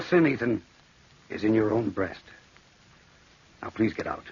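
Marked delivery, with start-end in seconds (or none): 3.6-4.3 cold dismissal. Acted. Brother sends him away.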